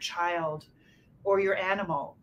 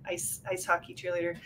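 usual speaking voice

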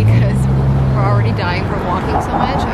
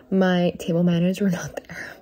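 embarrassed whisper; 3 semitones lower, 10 dB quieter than usual. Real, not acted. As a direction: whisper it, a bit ashamed. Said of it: "I am so embarrassed".

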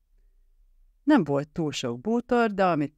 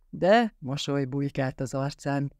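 ordinary delivery

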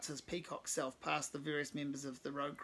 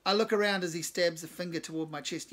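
ordinary delivery

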